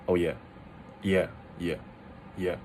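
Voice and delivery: "yeah" dropped low and short; casual third tone. Shown as a demonstration. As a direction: say "yeah" as an offhand low falling tone. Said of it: "That's a third tone".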